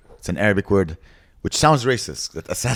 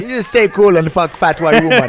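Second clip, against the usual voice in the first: easygoing Jamaican guy, pitched up; Jamaican accent; 9 semitones higher, 6 dB louder than usual. Retold or imitated. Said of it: "one of my favorite accents".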